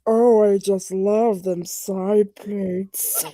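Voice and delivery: slow, drawn-out mock swoon; put-on lovestruck drawl; mock fan voice. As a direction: gush slowly like a hammed-up smitten fan. Mock fan shipping trains.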